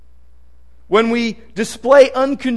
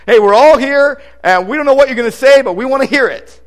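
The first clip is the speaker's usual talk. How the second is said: louder, quick and eager; eager crowd; 4 semitones higher, 7 dB louder than usual. Acted. Cornelius's household greets Peter, loud and eager. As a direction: sound like a crowd keen to listen.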